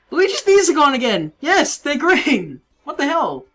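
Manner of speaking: loud, baffled exclamation; startled outcry. Real, not acted. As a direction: yell in disbelief at the screen. Calling out a recurring game glitch.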